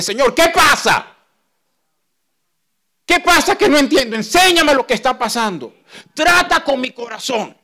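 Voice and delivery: high, loud, anguished plea; loud desperate prayer; pleading cry to God. Acted. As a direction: cry out to God, begging to understand.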